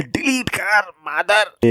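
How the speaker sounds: slow, high-pitched outburst; a cornered student finally yelling at the bully; sudden yell. Retold or imitated. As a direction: let it burst out all at once. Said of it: "एक ही झटके में चिल्लाता है".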